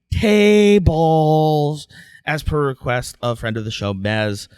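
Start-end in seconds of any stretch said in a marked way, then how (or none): 0.1-1.9 loud, drawn-out TABLES; hyped announcer shout. Pretend. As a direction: stretch it out long and loud like a ring announcer. Announcer hyping the show topic.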